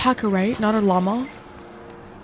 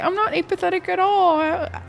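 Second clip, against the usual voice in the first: rising higher and higher into a squeaky protest; mock protest; 8 semitones higher than usual. Streamer reenacting her protest to chat, squeaky and higher. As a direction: play your past self overdoing the denial. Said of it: "I was like".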